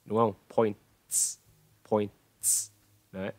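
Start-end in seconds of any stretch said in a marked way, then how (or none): 0.6-2.7 points ending in a crisp "ts"; final TS sound. Shown as a demonstration. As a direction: finish points on a fused "ts", no extra vowel. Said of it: "Thành âm ts". (points, bật rõ âm cuối "ts")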